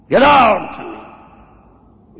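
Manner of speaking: loud, drawn-out shout; angry dismissal. Acted. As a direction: send them away with a long shout. Guru dismisses the complaining disciple.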